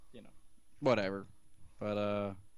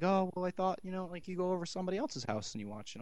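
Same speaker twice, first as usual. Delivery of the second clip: high, lightly voiced fussing mother; hint of a chatty mother; mom voice; 8 semitones higher than usual. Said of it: "She's like".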